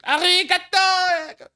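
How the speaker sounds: high, slow squealed anime voice; mock anime voice. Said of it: "talking in anime voice".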